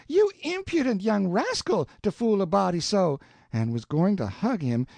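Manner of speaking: Aunt Sally hugging Sid, delighted; playful aunt. A narrator's reading. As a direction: burst out with joyful teasing. Tom retelling Aunt Sally's cry.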